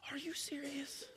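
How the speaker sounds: disbelieving whisper. Retold or imitated. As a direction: whisper it in awe and fear. Scared prayer to God.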